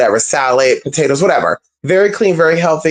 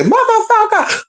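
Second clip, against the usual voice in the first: high, sassy swear tossed off as a joke; the swear she won't use, said in a mocking high voice; mock cussing. Shown as a demonstration. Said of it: "I'm not gonna say".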